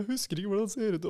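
lightly voiced woman rambling on; quoting her. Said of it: "Hun fortsetter med sin greie".

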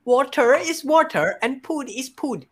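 put-on Filipino accent. Friend testing others with a mystery line, in a Filipino accent.

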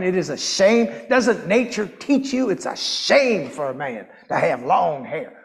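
quoting scripture in a Texas twang; Texas accent. Retold or imitated. The preacher's Texas family quotes scripture at him, Texas accent. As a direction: slip into a mock Texan scolding accent. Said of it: "I don't know why the accent came in there".

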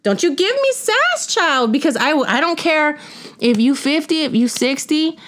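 high, slow sassy put-down; sassy scolding. Retold or imitated. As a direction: tell her off with sass, drawing out the word sass. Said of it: "I told her".

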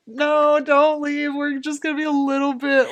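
pleading. Retold or imitated. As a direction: beg her not to leave. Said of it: "And I'm like".

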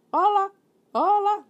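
high, loud parrot squawk from the chimney; parrot calling. Acted. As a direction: call out like a parrot from inside.

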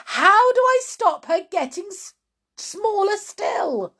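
high-pitched, loud wail of worry; distressed cry. Acted. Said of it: "and she cried".

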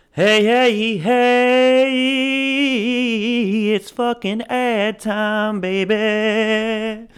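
over-the-top DJ shout-out; hyped announcer. Acted. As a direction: announce the ad break like a radio host. Host launches into ad break.

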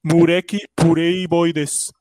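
slow Japanese-accented Portuguese. Shown as a demonstration. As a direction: drag it out like a Japanese speaker. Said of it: "japonês falando inglês".

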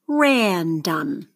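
"Random" with RAN stretched; stress on first syllable. Shown as a demonstration. Teacher models for seventh graders to mark, stretched stressed RAN in "Random". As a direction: say "Random" as RAN-dom, sliding down after RAN. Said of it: "circle the stress syllable".

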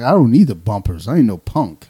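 loud, bragging refusal; retold defiance. Bowler refusing the bumpers, mock defiant. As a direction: puff up and wave off the help, imitating.

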